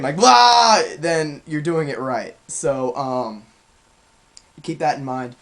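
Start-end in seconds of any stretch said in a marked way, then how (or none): 0.2-0.8 sudden loud high scream; real scream. Shown as a demonstration. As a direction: let out a short full-force scream. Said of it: "a real scream".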